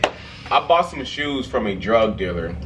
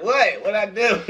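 innocent laughing question; 8 semitones higher than usual. Retold or imitated. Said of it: "I was like".

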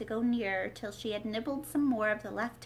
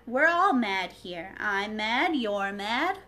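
louder, slow, teasing Cat; Cheshire Cat voice; 7 dB louder, slower than usual. Acted. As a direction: lean in and savour every mad. Cat's lines in read-aloud.

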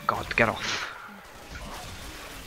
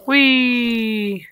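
high, bright mock glee; playful whee; 12 semitones higher, 4 dB louder than usual. Gamer jumps down and cries out in mock glee. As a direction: squeal it like on a slide. Dropping down in game.

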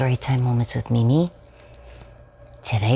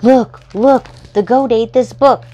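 higher, louder mock alarm; excited alarm; 11 semitones higher, 10 dB louder than usual. Storyteller finding the goat eating the book, playful alarm. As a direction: sound the alarm to the kids, playfully.

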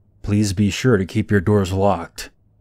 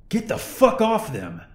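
much higher, furious yell; 13 semitones higher than usual. Retold or imitated. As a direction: roar at the attacker to get off. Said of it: "Ari yelled".

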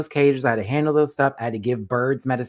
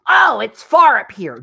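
higher, louder, slow casual hamster; mock hamster voice; 7 semitones higher, 11 dB louder than usual. Hamster pretending to enjoy the height, mock. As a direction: voice a relaxed hamster enjoying the view. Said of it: "hamster is not gonna go".

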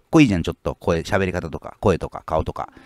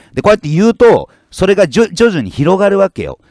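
heated rant; 10 semitones higher, 7 dB louder than usual. Real, not acted. Loud rant about changing language.

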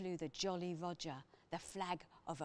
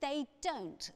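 firm; 8 semitones higher, 3 dB louder than usual. A narrator's reading. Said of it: "said P J firmly".

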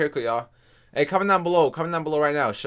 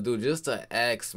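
ordinary talk, nothing marked

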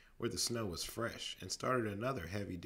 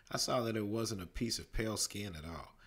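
ordinary delivery, nothing marked

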